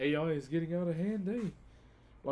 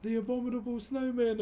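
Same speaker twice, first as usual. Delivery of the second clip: much higher and louder, a mock-excited bushman host; cheesy YouTuber greeting, pitched up high; hyped-up vlogger; 10 semitones higher, 4 dB louder than usual. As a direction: ham up a TikTok wilderness guy greeting his fans. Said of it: "this one guy goes".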